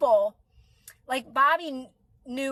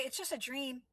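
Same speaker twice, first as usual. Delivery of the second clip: quiet self-reassurance; 15 dB quieter than usual. Retold or imitated. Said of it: "And I would say".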